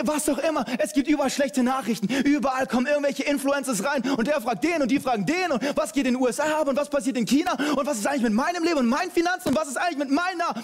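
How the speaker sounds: high, driving preacher voice; preacher rousing his listeners, high and fast; fired-up preaching. Acted. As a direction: rattle off the worries with building energy. Energetic preaching to the congregation.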